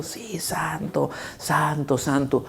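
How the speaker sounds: very soft, slow "santo, santo, santo"; hushed worship. Acted. Angels' worship, very soft and slow. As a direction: let each "santo" fall softly.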